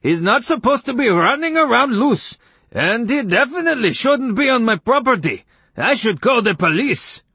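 high, loud indignant complaint; worked-up high-pitched threatening; irate neighbor. A narrator's reading. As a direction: rant in shrill outrage. Irate neighbor arguing about dog.

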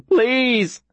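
high cracked plea; voice cracking. A narrator's reading. Boy with welling eyes in the empty square, voice cracking. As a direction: beg desperately, voice breaking upward. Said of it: "my voice cracking".

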